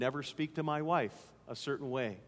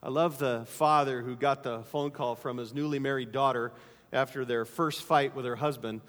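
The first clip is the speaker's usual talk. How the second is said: usual speaking voice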